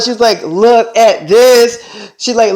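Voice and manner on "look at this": drawn-out, louder, high boast; showing off. Retold or imitated. Selena shows her friends the money, showing off. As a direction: stretch it out to flaunt it.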